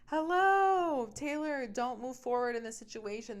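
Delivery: high, loud "Hello" hailing her; mock warning call. Scene: an imagined red flag warning Taylor to stop, shouted. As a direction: call out like an alarm bell.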